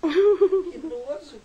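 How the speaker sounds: very drawn-out, loud, high disgust; disgusted outcry. Real, not acted. Reacting to the girl's fart, long disgusted wail. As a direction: stretch it out loudly in real disgust.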